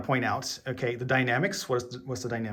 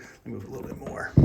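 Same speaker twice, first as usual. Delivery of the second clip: whisper; 2 semitones lower, 8 dB quieter than usual. Real, not acted. Aside while moving the camera.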